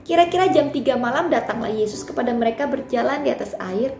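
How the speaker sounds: loud, fervent Bible reading; dramatic scripture reading. Acted. Preacher reads the storm passage to the congregation, loud and dramatic. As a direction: proclaim the passage with full force.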